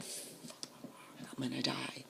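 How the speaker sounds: retold whispered dread; hushed, voiceless aside to oneself; fearful whisper. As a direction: whisper it as a scared private thought. Scared thought on smelling crack.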